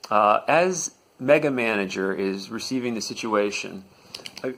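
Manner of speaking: ordinary delivery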